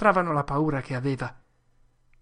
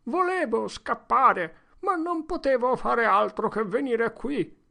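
tearful; 8 semitones higher, 4 dB louder than usual. Acted. Potter explaining to the crowd, high and tearful. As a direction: cry it out helplessly. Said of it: "E riprese a singhiozzare".

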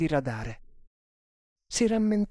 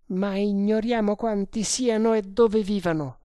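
sustained higher-pitched character voice; persuading sergeant; 6 semitones higher, 3 dB louder than usual. Acted. Sergeant bargaining with prisoner for information, persuasive. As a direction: press him to talk, voice raised high.

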